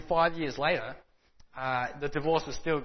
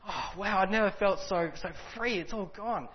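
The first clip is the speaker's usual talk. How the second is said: retold joyful outburst, pitched higher; exuberant and happy; 4 semitones higher than usual. Newly converted man in the cafe, higher and joyful. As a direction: lift it up like sudden relief. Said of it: "exuberant and happy".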